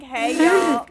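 loud, high Southern twang. Retold or imitated. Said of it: "me trying to be a hick".

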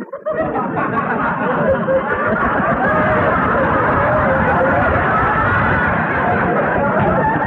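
the father laughing at his own insult; booming laugh. Acted. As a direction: roar with laughter. Father's big laugh at joke.

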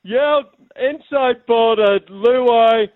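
high, loud, drawn-out try call; mock commentary climax. Caller pretending to call the winning try, roaring commentary. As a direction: stretch the names into a commentator's roar. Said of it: "call us the dying stages of the grand final".

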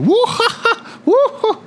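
very high-pitched whooping cackle; imitated maniac laugh, high and whooping; falling villain's cackle. Villain laughing as he's falling down, mock cackle. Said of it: "as he's falling down".

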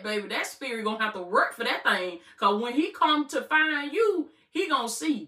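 lively, punchy sermon delivery with call-outs; fervent preaching. Acted. Preacher urging viewers to set boundaries, fired-up preaching. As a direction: preach it to the congregation, rising and driving.

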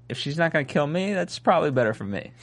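normal talking voice

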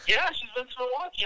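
high, incredulous retelling; mock amazement. Real, not acted. Telling his cousin about the escort, high and amazed. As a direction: sound still amazed.

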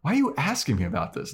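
high, fast, confused; baffled. Retold or imitated. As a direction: sputter in confusion. Xander's baffled reply retold.

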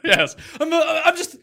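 laughing through a loud posh mock; cracking up mid-impression, shouting. Mocking friend's posh voice, laughing.

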